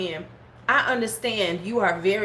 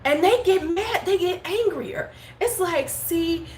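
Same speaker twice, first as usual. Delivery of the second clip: higher, amused telling; higher amused retelling; 8 semitones higher than usual. Real, not acted. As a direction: tell it up high with amusement. Amused about trolls.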